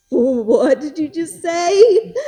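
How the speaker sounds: slow, loud, rising shock; startled mother, drawing out every word; retold gasping double take; stunned disbelief.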